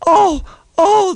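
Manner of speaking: very high loud squeal; high panicked truck scream. A narrator's reading. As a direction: shriek as you're jerked forward. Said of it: "they screamed".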